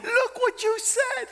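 high, loud mock whine; mock the snowflakes; whiny offended people. Mocking offended listeners, high whiny voice. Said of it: "they're like".

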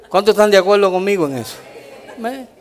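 usual speaking voice

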